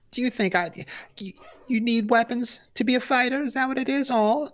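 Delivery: high, cooing mockery; mocking baby talk.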